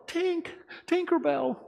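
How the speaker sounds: put-on high drawn-out child's call; children calling. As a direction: call the fairy's name like a worried child. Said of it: "they're all going".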